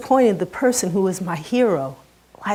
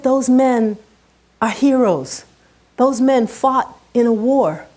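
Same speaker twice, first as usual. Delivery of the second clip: louder, higher, firm grandmother's voice; firm declaration; 5 semitones higher, 4 dB louder than usual. Retold or imitated. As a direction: declare it proudly and firmly. Grandmother defending the veterans.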